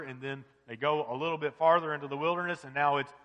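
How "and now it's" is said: ordinary talk, nothing marked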